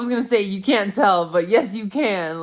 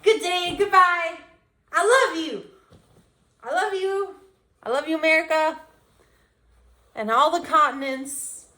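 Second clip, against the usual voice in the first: high, gushing over-the-top goodbye; cheesy pageant farewell; hammed-up farewell; 8 semitones higher, 3 dB quieter than usual. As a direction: blow kisses to the world.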